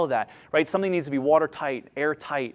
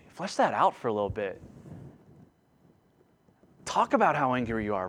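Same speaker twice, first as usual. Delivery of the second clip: God's gentle voice; 6 semitones lower, 8 dB quieter than usual. Pretend. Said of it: "It's like God saying".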